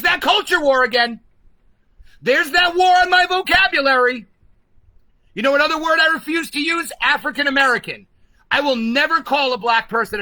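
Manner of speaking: fed-up rant at full volume, a little fast; outraged shout. Real, not acted. Vlogger ranting at viewers about vocabulary, real outraged shout.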